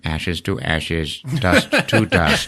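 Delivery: slow, mechanical recorded voice; robot voice. Retold or imitated. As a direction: intone like a cheap automated eulogy. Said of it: "computerized voice recording".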